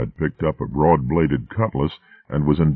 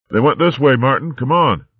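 otter calling out; 9 semitones higher than usual. A narrator's reading. Skipper calling.

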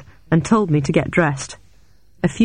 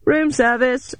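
higher sing-out room service call; voice outside the door; 8 semitones higher, slower than usual. A narrator's reading. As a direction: announce yourself through a closed door. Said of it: "replied a voice outside the door".

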